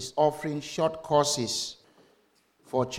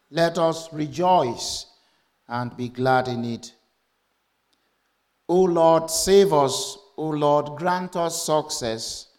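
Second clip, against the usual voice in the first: scripture proclamation; 5 dB louder than usual. Acted. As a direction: proclaim the psalm to the congregation, then ease down. Reads the call to worship.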